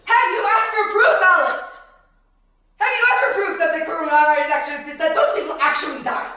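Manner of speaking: loud, pressing demand; heated challenge. Real, not acted. Preacher challenging Alex for proof, loud and heated. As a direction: press the question hard at full voice.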